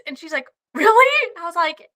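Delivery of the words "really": high, loud, surprised "really"; surprised question. Retold or imitated. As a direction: shoot it up high in disbelief. Brittany surprised at moose.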